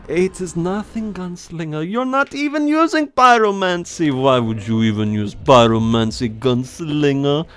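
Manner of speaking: put-on high, scoffing German-accented voice; Heinrich. German character scoffs at Gunslinger's pyromancy, high accented voice. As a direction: question it in a hammy German accent, stretching the last word. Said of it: "my old German voice".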